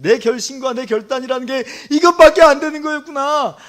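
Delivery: loud, high lament in Peter's role; acted bitter lament. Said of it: "하면서 가슴을 두들기면서". (가슴 치며 한탄하는 연기)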